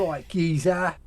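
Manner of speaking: Cockney accent. Shown as a demonstration. Playing a London geezer, Cockney accent. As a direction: toss it off in Cockney. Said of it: "right, Cockney".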